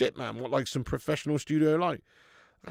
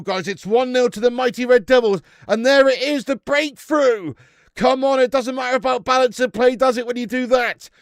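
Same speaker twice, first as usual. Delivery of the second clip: ordinary talk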